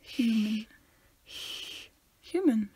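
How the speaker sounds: "Human" with a long, airy H; over-exaggerate the H that opens "Human"; exaggerated H. Shown as a demonstration. Friend testing the H sound, "Human" hugely over-aspirated. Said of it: "You don't have to over-exaggerate it".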